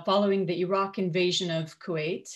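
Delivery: usual speaking voice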